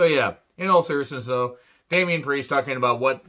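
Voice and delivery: ordinary talk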